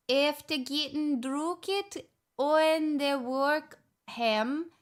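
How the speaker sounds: slow, laboured Scottish accent. Shown as a demonstration. Streamer reading Scots exactly as written, Scottish accent. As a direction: sound out the Scots slowly in a put-on accent. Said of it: "I'm reading exactly what's written".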